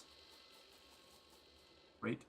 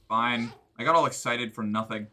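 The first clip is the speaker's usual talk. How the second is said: usual speaking voice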